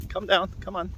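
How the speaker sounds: ordinary talk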